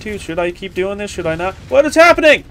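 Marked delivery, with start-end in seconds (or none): 1.7-2.4 high, startled outcry; alarmed disbelief. Real, not acted. Player seeing Volo in trouble, high alarmed cry. Volo suddenly dies in the game.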